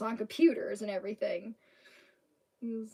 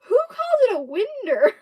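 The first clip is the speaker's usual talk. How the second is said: high, loud incredulous question; pitched-up bafflement; retold stunned disbelief; 12 semitones higher, 10 dB louder than usual. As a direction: act out being floored. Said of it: "And I was stunned".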